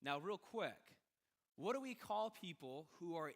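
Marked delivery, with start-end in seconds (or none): none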